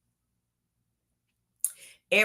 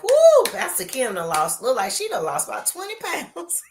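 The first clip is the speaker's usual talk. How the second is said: higher, louder excited gossip voice; gossiping church lady; 5 semitones higher, 4 dB louder than usual. Retold or imitated. Pastor playing a gossiper spreading her own rumor, excited and higher. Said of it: "I make up a rumor about myself like".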